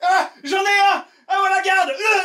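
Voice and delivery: high shouted alarm call; panicked shout. Acted. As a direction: yell for the guard in panic. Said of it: "tu lances, euh, fort".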